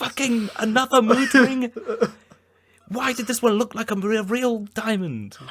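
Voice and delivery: high, slow, incredulous mock voice; mock exasperation. Wife discovers the diamond is a mood ring, high and exasperated. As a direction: drag it out high in disbelief. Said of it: "It's like".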